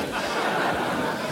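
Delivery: soft airless chuckle; breathy laugh. Real, not acted. Speaker laughs remembering crying to his wife. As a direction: laugh quietly at yourself.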